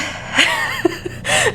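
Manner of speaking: a small, high laugh through tears; teary laugh. Real, not acted. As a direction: laugh while holding back tears. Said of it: "breathe through the tears".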